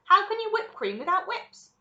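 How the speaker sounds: high, loud rhetorical question; indignant retort. Acted. Wonka schools Veruca about whipping cream, indignant. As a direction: argue as if it were obvious.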